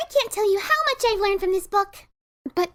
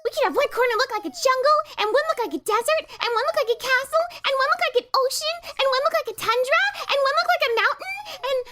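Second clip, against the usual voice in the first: rising higher with each item; piling up ideas, getting giddier; excited listing; 8 semitones higher than usual. Acted. Pinkie rattling off decorations, higher and higher.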